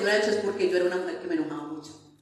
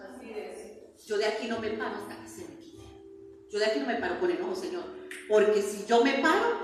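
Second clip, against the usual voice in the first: normal talking voice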